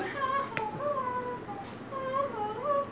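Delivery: high, soft, spaced-out laugh; mock pig giggle. Pigs safe inside mocking the wolf, high giggle. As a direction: titter high and light, clearly acted.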